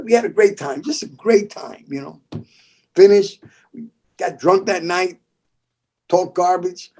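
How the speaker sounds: usual speaking voice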